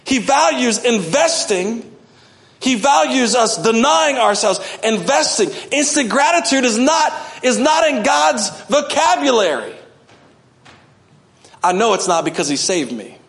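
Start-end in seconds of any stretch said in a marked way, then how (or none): 5.7-9.8 preaching at full force. Real, not acted. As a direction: drive it home to the congregation. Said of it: "preaching at full force".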